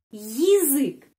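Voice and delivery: normal talking voice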